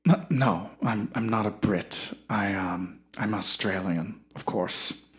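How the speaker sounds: pompous Shakespearean actor. Acted. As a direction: savour every word like a self-important thespian.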